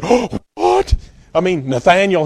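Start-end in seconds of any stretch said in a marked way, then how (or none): 0.0-0.9 high, disbelieving "oh, what?"; a hint of a shocked fisherman gasping; startled disciple. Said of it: "James said".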